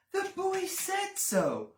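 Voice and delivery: high, slow protest; indignant little rabbit. Acted. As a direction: insist hurt but firm. Rabbit insists.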